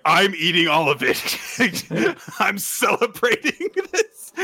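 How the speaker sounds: high, laughing declaration; imitated gleeful outburst. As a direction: play giddy celebration, breaking into laughter. Karen's gleeful outburst retold.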